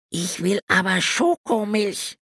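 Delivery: put-on high child voice, sulky; grumbling boy. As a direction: grumble it like a pouting little boy. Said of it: "murrte Wilfried".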